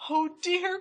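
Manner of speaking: put-on very high baby bear cry; crying wee little voice. A narrator's reading. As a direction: squeak it like a dismayed cub. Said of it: "cried Baby Bear in his wee little voice".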